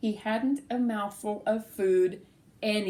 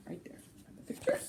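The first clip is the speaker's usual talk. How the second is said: quiet; 11 dB quieter, much faster than usual. Real, not acted. Pointing at picture, hushed.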